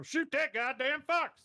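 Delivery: put-on slow, high, loud old-man yell; granddad yelling. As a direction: bark it out like a furious grandpa.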